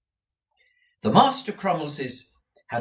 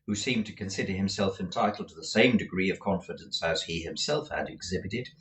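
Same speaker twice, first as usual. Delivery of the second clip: usual speaking voice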